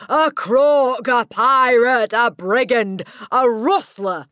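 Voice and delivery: high, very loud volley of insults; screaming. A narrator's reading. Headmistress piling accusations on the boy, screaming. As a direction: hurl each name at full volume. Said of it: "the Trunchbull screamed".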